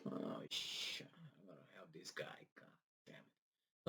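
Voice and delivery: very soft, whispered grumble; acted whisper to oneself; muttered aside. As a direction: grumble reluctantly under your breath. Acting the reluctant helper's private reaction.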